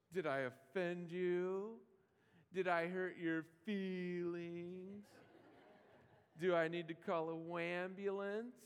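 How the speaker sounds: slow, drawn-out mocking sarcasm; mock them with fake sympathy; snarky. Jesus imagined sneering at offended disciples, slow snarky mockery. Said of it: "He might get snarky".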